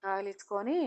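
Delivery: usual speaking voice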